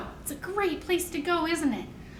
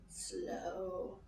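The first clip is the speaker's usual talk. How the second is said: slow and soft; 7 semitones lower, 19 dB quieter than usual. Shown as a demonstration. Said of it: "can you wiggle fast?".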